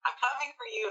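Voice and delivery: high-pitched menacing alter ego; Mister Hyde. Acted. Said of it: "Mister Hyde be like".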